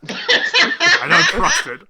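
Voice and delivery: high, slow, laughing distrust; laughing suspicion. Acted. GM voicing wary Lakeisha eyeing the coffee, laughing high and slow. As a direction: squeak it out through a laugh.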